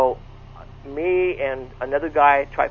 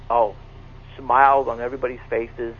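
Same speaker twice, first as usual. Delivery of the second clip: ordinary talk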